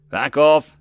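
loud barked warning; guard's shout. A narrator's reading. Guards drawing swords at the crowd, loud warning. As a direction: shout them back with authority.

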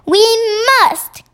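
high, emphatic cry; insistent cry. A narrator's reading. Sophie urges the BFG to go after them, crying out. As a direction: cry it out, pitched right up on the last word. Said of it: "Sophie cried".

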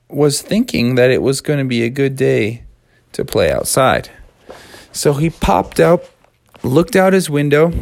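ordinary delivery, nothing marked

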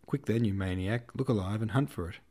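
ordinary delivery

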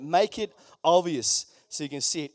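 usual speaking voice

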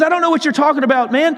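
fast, high-pitched mock protest; acted, rushed brush-off; defensive denial. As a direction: imitate someone hurriedly denying everything. Peter denying again when accused.

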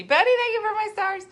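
high, loud quick thank-you; sing-song high livestream shout-out; bright shout-out. Real, not acted. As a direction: call out thanks to a viewer. Livestream thank-you called out mid-talk.

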